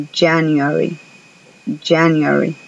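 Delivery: January with a strong Y-like J, teeth together; English J sound. Shown as a demonstration. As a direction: model January for the class. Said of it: "y decir".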